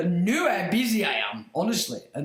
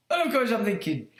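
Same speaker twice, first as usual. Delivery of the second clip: ordinary delivery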